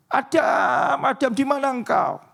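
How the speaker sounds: high, urgent calling out for Adam; panicked call. Acted. God panics, calling out for missing Adam. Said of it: "Dia teriak".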